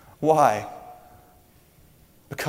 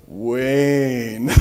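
star's greeting; 4 dB louder than usual. Retold or imitated. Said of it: "he goes".

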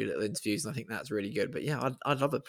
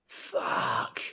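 drawn-out, hushed mock-awed curse; mock stunned reaction. Fan suddenly seeing the players hit, mock stunned. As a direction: play a fan stunned at close range. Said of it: "you'd be like".

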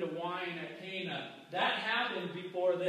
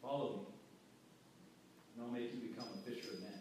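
much softer and lower, calm call; gentle invitation; 10 semitones lower, 14 dB quieter, much faster than usual. Retold or imitated. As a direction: invite him in softly, almost hushed. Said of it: "And then Jesus goes like this".

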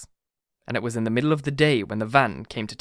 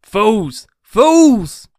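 high, loud, building yell; exasperated full-voiced cry; angry shout; 8 semitones higher, 11 dB louder than usual. A narrator's reading. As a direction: shout them down as fools. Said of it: "shouted Benjamin".

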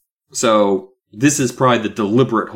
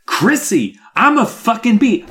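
excited exclamation; 11 semitones higher, slower than usual. Retold or imitated. Gary bursting to tell his sister Chrissy, excited. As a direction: blurt it out to your sister. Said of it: "He's like".

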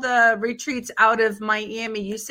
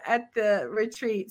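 ordinary talk

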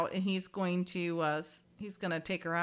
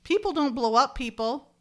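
louder and higher, laughing disbelief; exasperated outburst; 5 semitones higher, 6 dB louder than usual. Real, not acted. Podcaster mocking the exploding dummy, loud and incredulous. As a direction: call out the silly effect in disbelief.

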